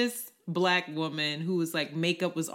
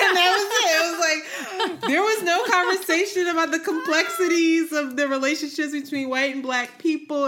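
much higher, louder words through laughter; laughing hard; 12 semitones higher, 8 dB louder than usual. Real, not acted. Telling a friend the punchline, laughing high and loud. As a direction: laugh through the whole line.

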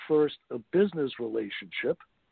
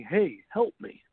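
mild mock yell, higher in pitch; retold yell; 4 semitones higher, slower than usual. Man dangling from a tree calls up to his friend, retold yell. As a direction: imitate a cry for help without really shouting. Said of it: "and he's yelling".

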